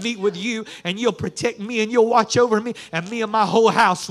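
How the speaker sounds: high, loud, impassioned preaching cry; revival-night preacher pleading at the top of his voice; full-force preaching. Acted. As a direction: pour it all out to the Lord from the pulpit.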